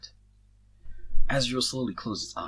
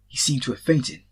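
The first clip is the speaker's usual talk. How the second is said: ordinary delivery, nothing marked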